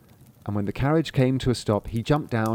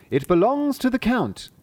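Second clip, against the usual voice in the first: mock answer; 12 semitones higher than usual. Cat dictates the answer.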